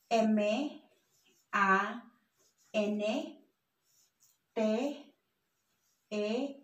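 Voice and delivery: M A N T E Q U I L L A spelt with Spanish letter names; name each letter in Spanish, leaving long pauses. Shown as a demonstration. Teacher spells a word for learners, spaced Spanish letter names. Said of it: "let's try to read this word".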